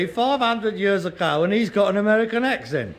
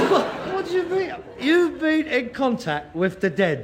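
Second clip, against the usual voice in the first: incredulous; 6 semitones higher than usual. Acted. Reacts to contact with dead.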